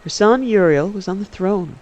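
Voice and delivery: ordinary delivery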